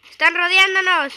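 loud panicked yell; alarmed shout. Real, not acted. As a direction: warn loudly you're surrounded. Surrounded by enemies.

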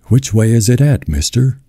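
meek, whiny question to a man in charge; whining. A narrator's reading. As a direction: plead in a mild whine, pitch lifted. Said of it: "Tom whined".

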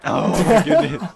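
loud laughing outburst; laughing exclamation. Real, not acted. Laughing at game chaos.

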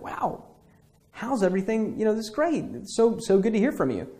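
acted delight at hearing from an old friend; excited. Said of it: "And you're excited, like".

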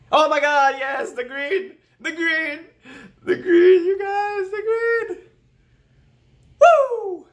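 very high, loud crying scream; ecstatic screaming. Real, not acted. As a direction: scream and sob with joy at the result. Sees passing result on screen.

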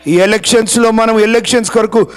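rally the congregation with force; fervent preaching. Acted. (ఉత్సాహంగా పిలుపు)

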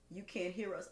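ordinary talk, nothing marked

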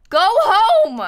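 loud, high mock-angry shout; high, exasperated holler; exasperated yell. Real, not acted. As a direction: yell it like you're fed up with an unwanted guest. Mock outburst at a game villager.

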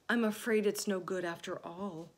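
ordinary delivery, nothing marked

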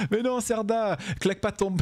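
high, giggling protest. Real, not acted. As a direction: squeal at the chat.

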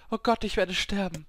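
high, urgent fearful cry; panicked realisation. A narrator's reading. Dying woman realising she will die, high panicked voice. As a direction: blurt it out in rising panic.

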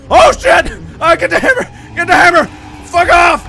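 frantic fearful shouts; panicked yelling. Real, not acted. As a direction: yell while fighting for life. Fighting off monster.

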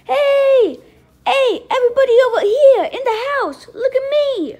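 loud, high yelling from up on the ledge; screaming for attention. Acted. Roy on the ledge yelling for everyone's attention. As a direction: holler to the whole house to look. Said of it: "all that screaming and yelling".

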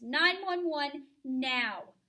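slow, loud urgent order; mother ordering the babysitter to dial now; urgent command. Acted. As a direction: drag out each word to force action.

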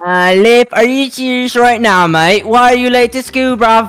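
put-on British teacher voice; British accent. Teacher to late pupil, scolding in British accent. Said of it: "I forgot you're British".

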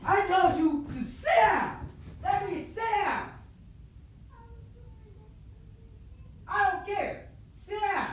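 high, slow yell from far off; yelling in background. Real, not acted. Sister yelling at little sister from another room, distant and high. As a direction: shout the order from another room. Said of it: "hear me yell in the background".

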